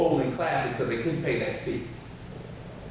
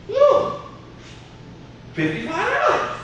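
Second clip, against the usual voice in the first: price shock. Retold or imitated. Dad seeing the shoe price, a shocked yelp.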